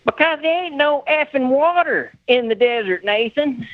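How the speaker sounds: high-pitched imitated Yankee accent. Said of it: "with his Yankee accent".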